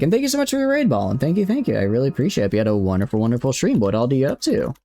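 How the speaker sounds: heavy Southern accent, gushing thanks; thick Southern accent. Shown as a demonstration. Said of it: "I'll bring out my accent here real, real thick".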